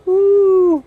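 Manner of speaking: a high, loud burst of delight; sudden happy woo, high and loud; excited woo. Real, not acted. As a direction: whoop it out, thrilled. Delight at smelling the soap.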